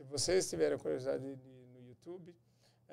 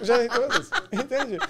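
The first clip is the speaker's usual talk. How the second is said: much louder, laughing through the words; higher, loud laughing admission; 8 semitones higher, 14 dB louder than usual. Real, not acted. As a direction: laugh it out as a confession.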